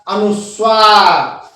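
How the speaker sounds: अनुस्वार slow, split as "anus... vaar"; drawn-out syllables. Shown as a demonstration. Teacher announcing the next topic, slow and syllabic. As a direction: stretch अनुस्वार out with a falling end.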